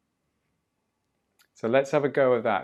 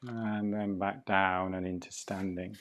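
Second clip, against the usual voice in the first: guided breathing; 8 dB quieter than usual. Acted.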